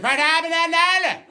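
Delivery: high, loud garbled Belfast repeat; garbled Northern Irish yell, rising high. Retold or imitated. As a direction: shout it in an impenetrable Belfast accent. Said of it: "I'm sorry, what?".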